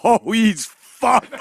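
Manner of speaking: slow, laughing through the words. Retold or imitated. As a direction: chuckle it out slowly. Retold reaction while laughing.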